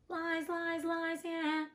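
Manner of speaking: high sing-song jeer of lies; mocking lies. Real, not acted. Reader jeering at the cat's obvious lie, high mocking sing-song.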